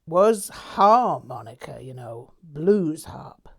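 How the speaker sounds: slow American Southern drawl, trailing off. A narrator's reading. Narrator naming his old name in a Southern drawl. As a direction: stretch it like a Southern blues player. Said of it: "I said in a southern drawl".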